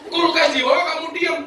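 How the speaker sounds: very high, incredulous scolding; indignant husband. Acted. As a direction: burst out at the wife in disbelief. Husband scolds wife once more.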